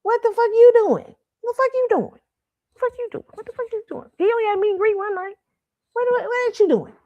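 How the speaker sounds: exasperated mock voice. Mocking the star's busy excuse.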